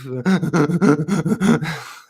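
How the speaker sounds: high, stuttering fake sobs; mock breathless sobbing. Man imitating how he cried when little, mock breathless sobs. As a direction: sob in short gasps, as if unable to breathe. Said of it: "that stage where you couldn't breathe".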